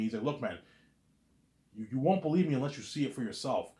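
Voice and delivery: ordinary talk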